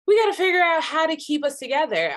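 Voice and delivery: rising to an urgent plea; urgent appeal. Retold or imitated. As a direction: urge it out loud, fast and high. Quotes own exasperated urgency about family.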